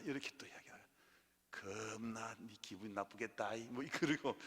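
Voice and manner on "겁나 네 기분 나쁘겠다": slow, low Jeolla-dialect sympathy; 느리고 낮은 전라도 사투리. Retold or imitated. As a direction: say it slowly in warm Jeolla dialect. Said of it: "전라도 말로".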